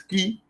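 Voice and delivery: "ki" said alone as the stressed syllable; stressed syllable demo. Shown as a demonstration. Teacher showing students where aquí is accented, stressed syllable demo. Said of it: "Lleva el acento en la última sílaba".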